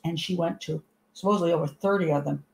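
ordinary talk

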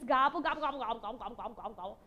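rapid run of gobbles, dropping away; turkey gobble. Shown as a demonstration. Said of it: "gobble your very best gobble".

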